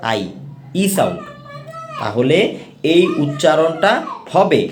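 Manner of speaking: ordinary delivery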